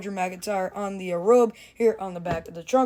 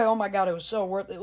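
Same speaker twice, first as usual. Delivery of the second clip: usual speaking voice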